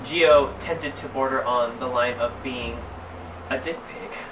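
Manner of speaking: plain reading voice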